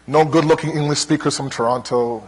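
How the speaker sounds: ordinary talk, nothing marked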